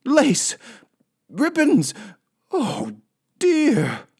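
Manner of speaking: high, loud cries of horror; shocked shrieks. Acted. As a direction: shriek each word in dismay. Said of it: "said Missus Rogers".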